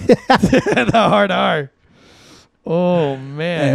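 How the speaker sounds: slow drawn-out line through laughter; laughing. Real, not acted. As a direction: savor it while laughing.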